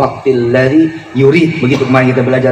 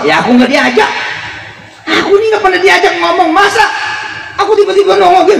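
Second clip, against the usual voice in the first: pitched way up, playful sulky voice; high mock complaining voice. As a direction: voice it high like a sulking friend who wasn't invited. Preacher voices Allah's complaint.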